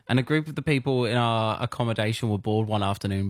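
ordinary delivery

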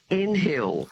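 "N Hill" as two words with the H sounded; mispronounced town name. Retold or imitated. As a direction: say "N Hill" split in two, like callers misreading it. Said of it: "no idea of pronunciation".

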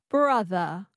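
Brother with dropped R, transatlantic; non-rhotic transatlantic Brother; transatlantic accent. Shown as a demonstration. Learner repeats after coach, transatlantic accent. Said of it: "you drop the R".

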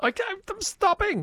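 high-pitched squeal of surrender; mock protest. Real, not acted. Host told by co-host to stop it, high mock protest.

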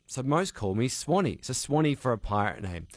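normal talking voice

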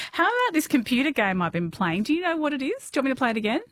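ordinary talk

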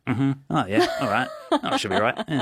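mock-casual bloke reassurance with a laugh in it; classic blokey mannerisms. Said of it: "just with classic sort of manisms".

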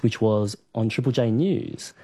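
ordinary talk

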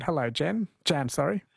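usual speaking voice

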